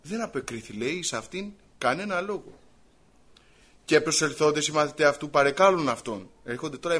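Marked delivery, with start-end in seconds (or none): none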